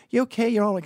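high, mock-caring check-in; sarcastic concern. To the harassing woman at the airport, high mock concern. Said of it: "I was being sarcastic and facetious".

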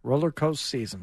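coaster cut short to "coast"; mocking mispronunciation. Co-host needling the host's slip, coaster softly clipped to "coast". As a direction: echo roller coaster season with coaster said as "coast".

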